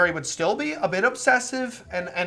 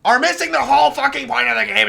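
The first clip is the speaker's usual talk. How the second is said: louder, higher, fast frustrated outburst; pissed off; 7 semitones higher, 7 dB louder, faster than usual. Real, not acted. Streamer defending the game's characters from critics, pissed off. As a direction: rant it with real irritation. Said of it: "and it pisses me off".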